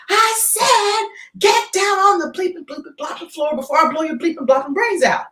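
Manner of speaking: hammed-up gunman ordering everyone down; mock robber yell. Robber threatening the bank customers, mock yell.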